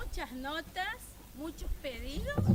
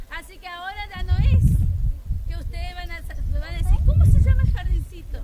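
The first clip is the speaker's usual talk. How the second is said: projecting to crowd; 16 dB louder than usual. Real, not acted. Speaking to a crowd outdoors.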